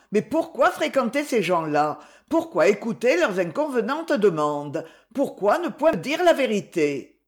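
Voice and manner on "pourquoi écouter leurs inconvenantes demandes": ordinary delivery, nothing marked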